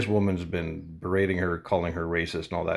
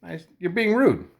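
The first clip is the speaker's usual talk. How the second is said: much higher, louder indignant retort; heated retort; 12 semitones higher, 5 dB louder than usual. Retold or imitated. Scolding the customer for being rude, much higher and louder. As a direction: raise it, you're defending the cashier. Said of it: "I'm like".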